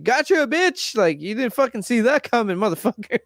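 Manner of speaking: hint of a smug shopkeeper rubbing it in; gloating shop owner. Shop owner taunting Carly Beth about the stolen money, lightly mock smug.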